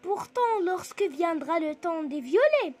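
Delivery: expressive classroom recitation with lifted phrases; poem recital. Shown as a demonstration. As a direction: recite phrase by phrase, pausing between.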